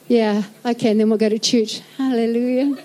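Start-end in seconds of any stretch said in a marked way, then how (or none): none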